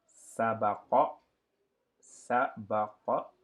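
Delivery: sabaqa read slowly, syllable by syllable; slow Arabic reading. Shown as a demonstration. Teacher reading sabaqa slowly for students. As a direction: draw out sabaqa twice. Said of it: "Donc, lima".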